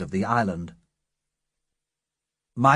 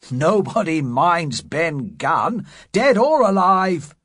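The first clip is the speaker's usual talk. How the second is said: rising louder, scornful cry; swelling louder bold retort; relieved cry; 6 semitones higher, 5 dB louder, slower than usual. Acted. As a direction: laugh off the fear loudly. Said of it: "cried George".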